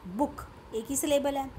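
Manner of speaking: usual speaking voice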